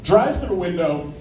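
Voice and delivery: ordinary talk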